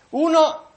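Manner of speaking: Uno said high and loud as a strong accent; emphatic. Real, not acted. Preacher introduces the first witness to his flock, a loud emphatic Uno. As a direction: punch Uno hard to open the point.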